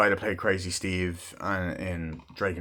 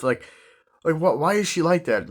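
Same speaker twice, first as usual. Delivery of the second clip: ordinary talk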